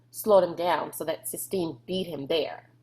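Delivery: plain reading voice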